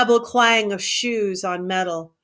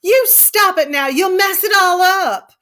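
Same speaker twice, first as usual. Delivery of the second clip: sharp, much higher, louder scolding; angry cry; 10 semitones higher, 6 dB louder than usual. A narrator's reading. Curly's wife angrily crying out at Lenny. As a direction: snap at him to stop now. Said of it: "she cried angrily".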